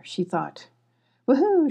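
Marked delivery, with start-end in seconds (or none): 1.3-1.7 high, louder single woohoo hoot; owl hoot. Acted. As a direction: call it out like an owl. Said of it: "she hooted".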